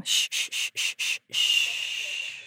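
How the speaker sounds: whispered shushing; soft, slow hushing noises; hushing sound effect. Pretend. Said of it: "that's good sound effects".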